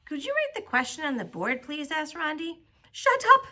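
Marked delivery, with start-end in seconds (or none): 2.9-3.5 high, loud, exasperated yell. A narrator's reading. Teacher at breaking point over questions, yelling. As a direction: explode at the class. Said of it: "Missus Jules yelled a third time".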